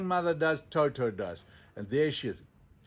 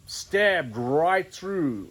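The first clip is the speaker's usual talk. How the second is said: "stabbed right through" drawn out very slowly and louder; dramatic emphasis; 3 semitones higher, 5 dB louder, much slower than usual. Retold or imitated. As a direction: lean hard on "stabbed", stretch each word. Dramatic retelling of skull wound.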